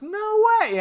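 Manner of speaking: very high, drawn-out no way; stunned no way. Retold or imitated. Josh hearing they are related, very high disbelief. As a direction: gasp it out in disbelief.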